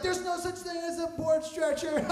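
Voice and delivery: high-pitched jeer, about to laugh; mocking crew. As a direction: taunt like coworkers enjoying a prank. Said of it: "They all start laughing at me".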